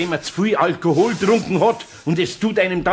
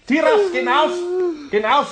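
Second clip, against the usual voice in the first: shooing the dog; 11 semitones higher than usual. Acted. Eder chasing the dog out.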